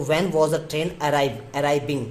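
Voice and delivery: slow, word-by-word dictation. Shown as a demonstration. Teacher dictating the sentence to students, slowly. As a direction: spell it out word by word for the class. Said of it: "dictating the sentence".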